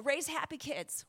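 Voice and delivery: ordinary talk, nothing marked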